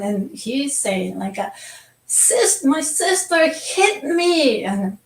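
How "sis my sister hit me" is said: put-on high, slow whiny little boy; whining child. Mother imitates crying son blaming sister, high whine. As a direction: whimper it out like a hurt little boy.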